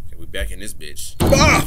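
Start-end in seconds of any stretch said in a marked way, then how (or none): 1.2-1.7 very high, loud yelp; sudden short shriek; startled scream. Real, not acted. Sudden scream while playing.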